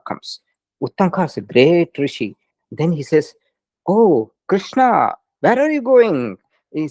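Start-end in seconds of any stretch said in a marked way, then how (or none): 3.9-6.4 high, drawn-out opening, lightly acted greeting; surprised hail. A rishi meets Krishna leaving by chariot, surprised hail. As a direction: hail him high and wondering, as in a told story.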